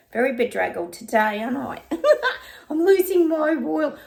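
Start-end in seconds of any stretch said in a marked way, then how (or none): 1.9-2.6 sudden high, loud giggle; high burst of laughter. Real, not acted. Laughing at herself over looking bedraggled, high giggle. 2.7-3.9 mock dismay. Jokes about slipping royal act.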